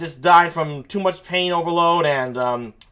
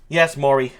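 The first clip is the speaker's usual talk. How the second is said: usual speaking voice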